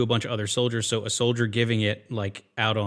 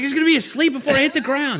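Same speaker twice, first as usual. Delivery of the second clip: mock panic; 6 dB louder than usual. Panicked climber voiced in retold scene.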